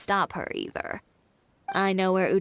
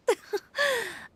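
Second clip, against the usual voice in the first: bright amused chuckle, pitched high; a quick light laugh; short laugh; 14 semitones higher than usual. Real, not acted. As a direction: laugh off the silly choice. Amused at either-or question.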